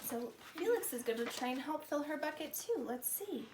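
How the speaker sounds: ordinary delivery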